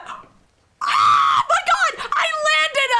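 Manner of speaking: high, loud, real shriek of joy; stunned, ecstatic cry; overjoyed shout. Real, not acted. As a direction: burst out screaming with good news. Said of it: "I'm so happy about this".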